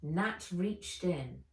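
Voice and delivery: usual speaking voice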